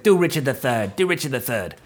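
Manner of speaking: lightly voiced movie bum; Michael Pollard. Imitating the bum in Scrooged, lightly put on. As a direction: mimic a film character's pleading request. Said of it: "He's like".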